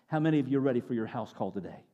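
ordinary delivery

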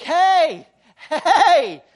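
loud, high retold yell; woman yelling after them in the lot; calling out. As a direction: shout across a dark parking lot. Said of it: "somebody's screaming".

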